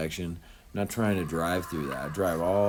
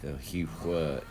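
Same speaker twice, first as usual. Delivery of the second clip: North Carolinian southern twang; southern-accented catchphrase. Acted. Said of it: "he give me that southern accent".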